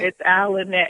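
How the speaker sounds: usual speaking voice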